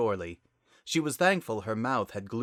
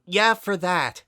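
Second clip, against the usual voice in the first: louder, higher burst; frustrated outburst; 10 semitones higher, 9 dB louder, slower than usual. Acted. Sunset exploding at her sister, a loud frustrated burst. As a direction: snap it out at last.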